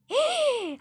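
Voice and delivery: high offended gasp; sharp high gasp; shocked gasp. Acted. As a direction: gasp as if deeply insulted.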